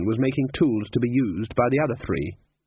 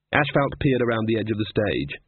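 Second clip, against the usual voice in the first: ordinary delivery, nothing marked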